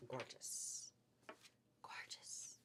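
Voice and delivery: hushed, voiceless whisper; awed whisper. Real, not acted. Seller unveils a blue fabric to shoppers, awed whisper. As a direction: whisper it in admiration.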